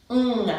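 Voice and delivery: NG voiced alone as a nasal hum; isolated NG sound. Shown as a demonstration. Teacher demonstrating mid-tongue placement, NG sounded alone. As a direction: lift the pitch and sound out NG by itself. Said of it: "you say the sound".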